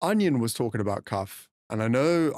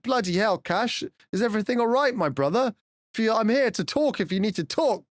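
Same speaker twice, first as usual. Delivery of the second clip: higher-pitched, worried check-in; mock concern; 7 semitones higher than usual. Streamer retelling his worry for a friend, concerned. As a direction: act out an alarmed offer of support. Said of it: "I was a bit concerned".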